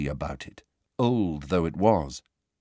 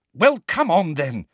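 louder, higher-pitched hurry-up; brisk, raised-pitch command; impatient urging; 8 semitones higher, 5 dB louder than usual. A narrator's reading. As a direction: chivvy a friend along impatiently. Hermione impatiently urges him along.

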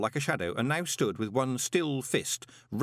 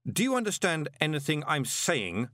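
higher, exasperated restrained shout; mild shout; 7 semitones higher than usual. A narrator's reading. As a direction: demand it in exasperation. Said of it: "shouted Moist".

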